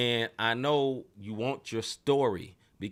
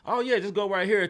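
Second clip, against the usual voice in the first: polite helper voice; 7 semitones higher, 4 dB louder than usual. Retold or imitated. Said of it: "you go from".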